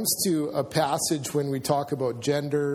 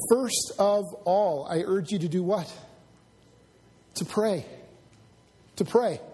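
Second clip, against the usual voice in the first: urgent; 4 semitones higher than usual. Acted. Preacher quoting Paul's call to pray, urgent. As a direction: urge the congregation, landing each phrase with weight. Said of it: "Paul is so urgent".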